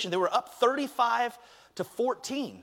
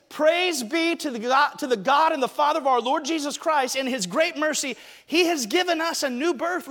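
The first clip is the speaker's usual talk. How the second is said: scripture reading; 8 semitones higher, 5 dB louder than usual. Acted. Pastor reading First Peter to the church, proclaiming.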